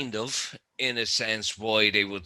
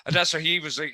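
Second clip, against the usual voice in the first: ordinary talk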